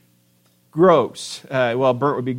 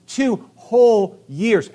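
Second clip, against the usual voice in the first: two whole years stretched slowly, whole rising; emphasis on whole; 6 semitones higher, 3 dB louder, much slower than usual. Shown as a demonstration. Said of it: "But there's emphasis".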